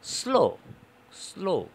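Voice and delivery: "স্লো" twice with hissed opening s; initial s demo. Shown as a demonstration. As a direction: separate takes of "স্লো", s held before the l. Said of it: "প্রথমে এস একটা শব্দ আসছে".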